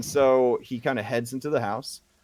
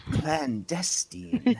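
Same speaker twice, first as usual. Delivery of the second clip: drawn-out, higher, savoring the word; leaning in conspiratorially; 6 semitones higher, much slower than usual. Acted. Old woman leaning in close to visitor, conspiratorial. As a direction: relish it slowly, like a secret. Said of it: "she leans in close".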